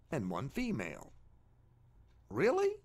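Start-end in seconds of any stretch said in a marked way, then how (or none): 2.3-2.7 a much higher, louder incredulous "Really?"; sarcastic disbelief. Acted. Scoffing at blind chance making male and female, mock disbelief. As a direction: leap up in mock astonishment. Mocking the idea of identical organisms.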